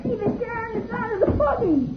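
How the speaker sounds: usual speaking voice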